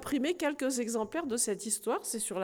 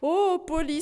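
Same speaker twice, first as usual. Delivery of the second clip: higher, louder happy exclamation; joyful cry; 6 semitones higher, 7 dB louder than usual. Acted. Knight greeting his returning parrot with a joyful cry. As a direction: cry out with joy. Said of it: "s'écria-t-il".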